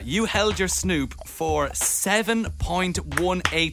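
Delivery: slow motion. Acted. Host reads the result slowly.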